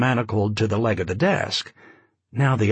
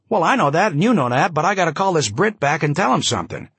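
raised, rapid newsman patter; breathless snap-brim talker; 6 semitones higher than usual. Acted. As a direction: fire it off like an old columnist. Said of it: "his breathless, snap-brim way of talking".

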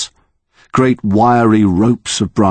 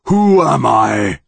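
slow, louder and higher booming question; louder monster; 6 semitones higher, slower than usual. Acted. Monster demanding Connor know it, slow booming shout. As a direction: let it swell into a roar. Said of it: "its voice getting louder".

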